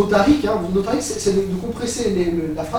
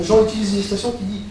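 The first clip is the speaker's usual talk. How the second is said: ordinary delivery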